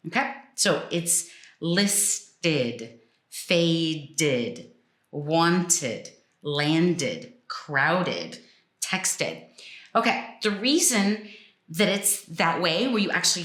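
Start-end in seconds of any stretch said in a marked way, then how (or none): 1.6-9.4 slow word list, each ending in a clear "id"; measured list, short I in every last syllable; full ID syllable. Shown as a demonstration. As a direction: sound out "listed", "faded", "wanted" in two even syllables. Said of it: "the sound is id".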